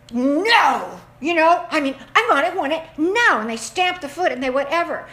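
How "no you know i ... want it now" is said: put-on louder, whiny kid insisting; demanding child. Mimicking a child demanding something, loud and whiny. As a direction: stamp your foot like a spoiled child. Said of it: "a child that is going".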